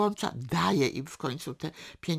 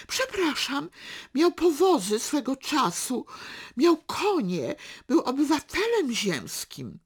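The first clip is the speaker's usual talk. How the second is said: indignant retort; 9 semitones higher, 4 dB louder than usual. A narrator's reading. Aunt indignantly answers father's insult.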